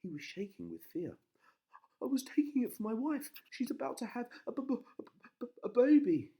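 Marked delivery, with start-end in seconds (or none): 2.0-6.3 high, loud stammering; scared stammer. A narrator's reading. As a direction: stammer in fear. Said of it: "he stammered".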